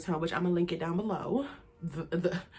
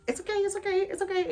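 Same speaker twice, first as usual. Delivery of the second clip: repeated it's okay, pitched much higher; high reassuring; 12 semitones higher than usual. Retold or imitated. She brushes off her friend's plea, high, repeated it's okay. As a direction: brush it off brightly, over and over.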